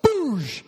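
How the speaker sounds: loud, high "boosh" burst; explosive entrance sound effect; imitated burst. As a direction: shout a sudden whooshing crash. Imitating Jesus bursting into bedroom.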